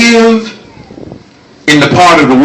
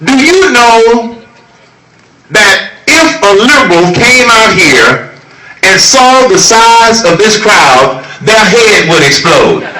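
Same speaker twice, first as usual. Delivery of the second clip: rally oratory; 12 semitones higher than usual. Real, not acted. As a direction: hammer each line home to the crowd. Rally speech at full force.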